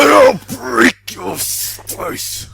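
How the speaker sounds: loud, high wrestler growl; Hulk voice. Retold or imitated. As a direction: bellow it like a pumped-up wrestler. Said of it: "We good, Hulk?".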